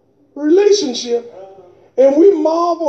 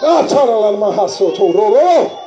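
speaking in tongues; 5 semitones lower than usual. Real, not acted.

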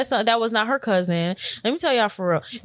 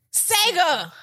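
proud answer; 8 semitones higher than usual. Retold or imitated. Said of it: "Mark said".

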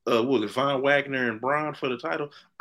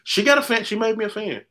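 excited; 9 semitones higher, 5 dB louder than usual. Real, not acted. Gushing about a wrestler.

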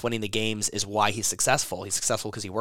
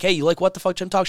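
higher, louder eager question to his wife; eager pitch; 9 semitones higher, 5 dB louder than usual. Retold or imitated. Asking his wife about the podcast name, eagerly. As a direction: sell the name, bright and hopeful.

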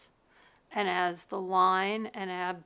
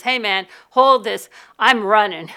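loud, hurried call; urgent hurry; 12 dB louder than usual. Retold or imitated. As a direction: toss it over and bolt. Hands off backpack, rushing away.

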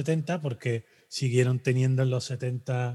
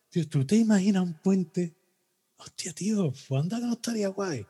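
barfly daydreaming; 6 semitones higher than usual. Retold or imitated. Guy at the bar dreaming up the bridge, lightly voiced and higher.

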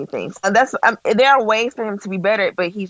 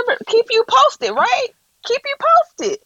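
high, sing-song mockery of his promise; mocking quote; 11 semitones higher than usual. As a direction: sneer his own words back at him. Mocking the father's empty promise.

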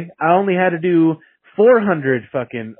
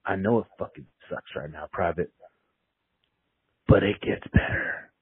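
much softer, low, breathy whisper; 6 semitones lower, 8 dB quieter than usual. Retold or imitated. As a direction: whisper the comfort into his ear. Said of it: "he just whispered in your ear".

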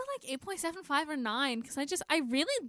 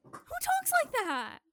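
high, slow incredulous complaint; hammed-up bafflement, pitched way up; mock exasperation; 13 semitones higher, slower than usual. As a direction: stretch it out in disbelief at a terrible line. Acted exasperation at a terrible line.

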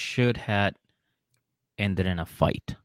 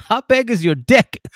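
much higher and louder, cracking up; laughing shout; 13 semitones higher, 8 dB louder than usual. Real, not acted. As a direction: yell it through a real laugh. Laughing retort shouting the line.

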